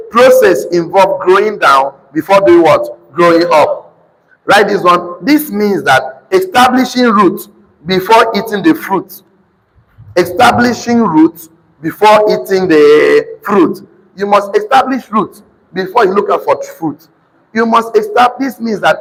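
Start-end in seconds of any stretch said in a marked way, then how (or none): 5.2-13.9 deliberate, drawn-out teaching point; slow dictation. Real, not acted. Preacher dictating the next note to congregation, slow and deliberate. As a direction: give each phrase time to be written.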